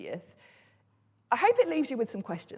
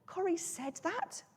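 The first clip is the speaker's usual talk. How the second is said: high, soft, slow questioning; doubting disbelief; 8 semitones higher, 9 dB quieter than usual. Acted. Preacher replaying a reply to friend's message, doubting disbelief. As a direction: lift "that" in hushed incredulity. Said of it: "makes it sound like I doubt".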